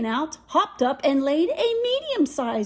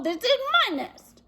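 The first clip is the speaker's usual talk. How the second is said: high indignant chicken; 10 semitones higher than usual. Acted. Chicken claims nest.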